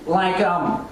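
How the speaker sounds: ordinary talk, nothing marked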